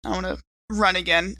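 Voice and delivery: normal talking voice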